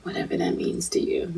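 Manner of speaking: very soft, whispered aside; hushed echo. Real, not acted. Podcast host echoing her co-host to listeners, whispered. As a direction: whisper it as an afterthought.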